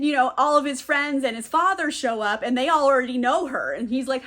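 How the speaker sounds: ordinary talk, nothing marked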